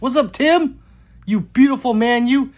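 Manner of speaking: loud, high, effusive welcome; hearty loud greeting. Real, not acted. Streamer greets Tim in the chat, loud and hearty. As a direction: hail a friend at full volume.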